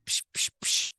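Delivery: sharp hissed "psh" slaps; quick slapping noises made by mouth; imitated slap sounds. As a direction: voice little slaps as bursts of air. Imitates slaps from a loving elder.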